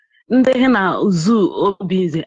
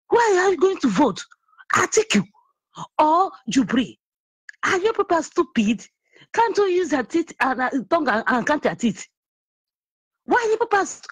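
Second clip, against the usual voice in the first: high, heated scolding of the listeners; raised, scornful rant; angry scolding; 8 semitones higher than usual. Real, not acted. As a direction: tell them off, exasperated. Scolding callers, high pitch.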